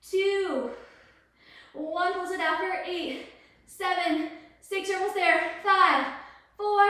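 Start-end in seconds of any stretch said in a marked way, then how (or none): 5.6-6.8 louder count on five; belted count. Real, not acted. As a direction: punch the count to push them. Pushing through final reps.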